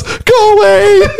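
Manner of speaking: high, slow, drawn-out wail; hammed-up tearful plea to be left alone; mock crying. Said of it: "He's crying".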